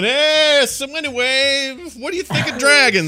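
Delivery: high, loud, chirpy filler to kill a silence; cheerfully change the subject, way too bright; nervous party small talk breaking the silence; forced small talk. Retold or imitated. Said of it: "I'll just start going".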